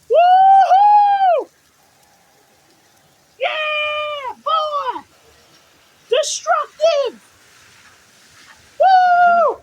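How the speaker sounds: loud high whoops and yells; excited whooping. Real, not acted. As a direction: cheer wildly at the storm. Cheering the storm.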